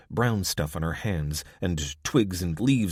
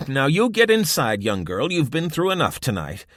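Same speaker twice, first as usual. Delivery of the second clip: higher, louder brisk order; gruff old woman; 7 semitones higher, 6 dB louder than usual. A narrator's reading. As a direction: send the girl indoors firmly. Irma orders girl inside.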